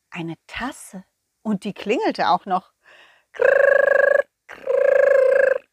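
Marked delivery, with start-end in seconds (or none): 3.3-5.6 high buzzing ring sound; phone ring imitation. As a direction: imitate an old telephone ringing. Cup phone rings.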